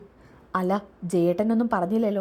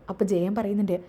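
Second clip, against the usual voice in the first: ordinary delivery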